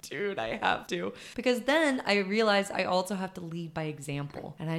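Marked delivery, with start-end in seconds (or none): 0.0-1.1 said while laughing; laughing through it. Retold or imitated. Aunt to nephew, laughing while explaining. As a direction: laugh out the words, slowly. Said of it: "I was like".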